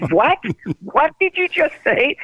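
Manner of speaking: half-mocking disbelief, voice jumping high; retold stunned disbelief. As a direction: echo his shocked 'what?' as you retell it. Axl stunned by anniversary remark.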